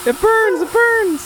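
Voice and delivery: very high squealing shriek; hammed-up high-pitched yelp of pain; girlish scream. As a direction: scream it like a girl. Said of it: "scream like a girl".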